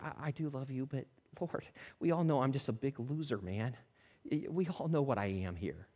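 soft, downcast voice of a man owning his failure; ashamed quiet confession. Acted. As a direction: let it sink to a low, ashamed hush. Peter ashamed before Jesus.

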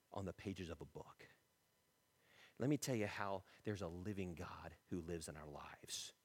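low, soft, intimate telling; tender testimony. Acted. Parent telling a child about God, softly and tenderly. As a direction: bring it right down and confide it gently.

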